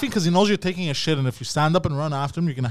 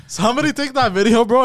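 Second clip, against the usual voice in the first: laughing call; 6 semitones higher, 4 dB louder than usual. Real, not acted. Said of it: "repeats laughing louder".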